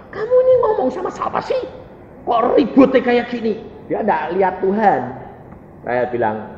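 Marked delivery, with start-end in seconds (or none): 0.1-3.8 high, complaining friend's voice; puzzled roommate. Retold or imitated. Roommate asking who the noise is for, high and baffled. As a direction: complain in a pitched-up mimicking voice. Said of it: "teman saya sekamar itu bilang".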